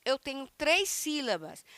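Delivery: normal talking voice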